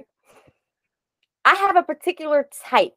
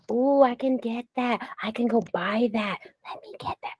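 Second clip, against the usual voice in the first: softer, slowing into a whisper; greedy little whisper; tempted whisper; 8 dB quieter than usual. Retold or imitated. Shopping temptation, self-quoted.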